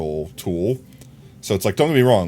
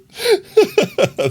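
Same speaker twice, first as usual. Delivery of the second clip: tiny chipmunk-pitched giggle; high squeaky laugh as a tiny figure; 8 dB louder than usual. Pretend. Squeaky laugh as mini doll.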